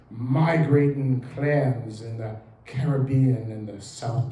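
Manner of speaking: slow, hushed British nature documentary narration; David Attenborough impression. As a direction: read it like a wildlife documentary, slow and awed. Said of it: "in my best David Attenborough voice".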